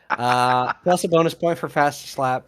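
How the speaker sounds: ordinary delivery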